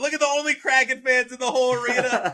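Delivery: fast, loud, high with laughter; excited laughing. Real, not acted. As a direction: laugh and point at the screen in glee. Live goal celebration on screen.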